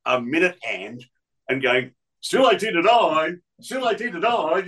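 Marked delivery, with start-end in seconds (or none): 2.2-4.7 higher, straining time call; mock DJ stall. Imitating Poco stalling the clock, mock strained voice. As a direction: drag the minute hand as a joke. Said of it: "pretend to be grabbing a minute hand".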